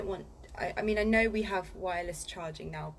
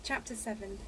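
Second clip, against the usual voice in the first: plain reading voice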